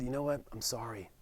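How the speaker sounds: the nurse apologizes to us, hushed and soft; soft apology. Retold or imitated. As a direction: say sorry gently, almost under the breath.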